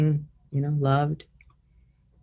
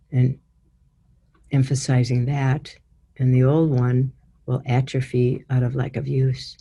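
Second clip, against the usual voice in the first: guided meditation. Acted. Leads guided healing visualization.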